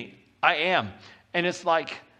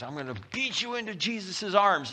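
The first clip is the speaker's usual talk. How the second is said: mock bully preacher; 6 semitones higher than usual. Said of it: "wanna browbeat people into the kingdom".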